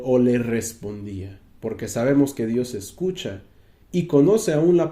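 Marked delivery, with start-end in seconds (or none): none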